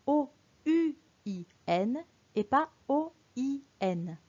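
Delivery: "O U I N" letter by letter, a pause after each; spelled out. Shown as a demonstration. Teacher showing a spelling exception, "O U I N" spelled out letter by letter. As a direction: spell "O U I N" as separate letter names. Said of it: "on va lire et écrire".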